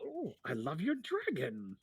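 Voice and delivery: slow, admiring little tinkerer; Feldwin. Acted. Eccentric halfling admiring a visitor's dragon, slow and delighted. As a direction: coo slowly over a cute creature. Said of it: "Um, he's like".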